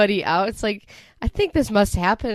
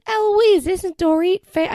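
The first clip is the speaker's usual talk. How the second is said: much higher, gushing country bumpkin; 11 semitones higher, 5 dB louder, slower than usual. Retold or imitated. Denise greeting Dorit as a starstruck country bumpkin, high and gushing. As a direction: gush like a starstruck yokel. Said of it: "plays it up like she's some country bumpkin".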